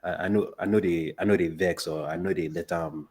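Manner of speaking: unhurried Nigerian Pidgin; Nigerian accent. Shown as a demonstration. Guest describing a healing ankle, Nigerian Pidgin. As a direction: talk through it in Nigerian Pidgin English. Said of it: "talk in your accent".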